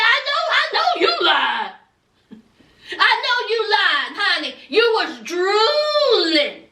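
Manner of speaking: high teasing call-out, last word stretched; mock accusation. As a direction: act out a gleeful accusation, drawing out the final word. Acting out [name]'s taunting accusation.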